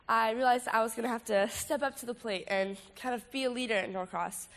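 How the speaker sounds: normal talking voice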